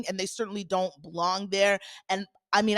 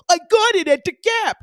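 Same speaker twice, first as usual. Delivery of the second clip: squeaky mocking voice, much higher and louder; mock Gina; 9 semitones higher, 9 dB louder than usual. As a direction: parody her proud answer in a high squeal. Mocking Gina's reply.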